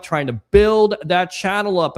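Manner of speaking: usual speaking voice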